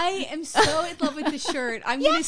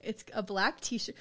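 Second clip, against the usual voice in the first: ordinary delivery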